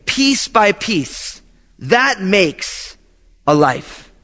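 usual speaking voice

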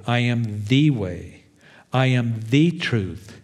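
"the" said as thee, slow and weighted in "I am the way"; slow down and lean on "the"; slow, stressed the. Shown as a demonstration. Preacher stressing the one way, slowly.

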